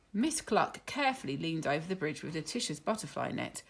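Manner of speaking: ordinary delivery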